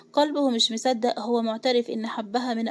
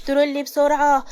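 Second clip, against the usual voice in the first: louder, urgent call for help; narrator's raised emergency cry; calling at the top of voice; 3 semitones higher, 5 dB louder than usual. A narrator's reading. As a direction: call out for a stretcher, fast and urgent. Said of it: "وهو بيقول بأعلى صوته".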